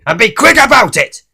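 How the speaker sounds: loud, high rude demand; snapped. Acted. Mister Grumpy snaps his order at Mister Meat, loud and rude. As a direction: bark the order at the butcher. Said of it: "snapped Mister Grumpy".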